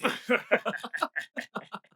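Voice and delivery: long high gloating laugh. Acted. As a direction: laugh like a smug villain. Villains gloating over drinks. (rire long et satisfait)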